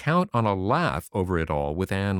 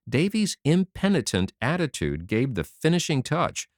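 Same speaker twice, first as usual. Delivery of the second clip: plain reading voice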